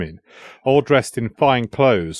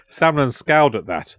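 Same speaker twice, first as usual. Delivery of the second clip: ordinary delivery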